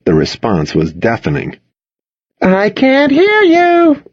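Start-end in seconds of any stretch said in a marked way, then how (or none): 2.4-4.0 very high, stretched-out crowd taunt; high pep-rally challenge, words held long; challenging call. A narrator's reading. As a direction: sing it out slowly, daring the crowd to respond. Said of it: "Jill challenged".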